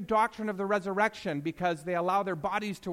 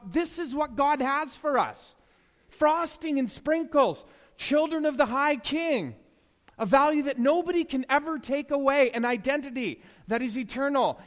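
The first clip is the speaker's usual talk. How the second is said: full-force preaching; 6 semitones higher, 6 dB louder than usual. Acted. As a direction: lift the room with it. Preaching builds to climax.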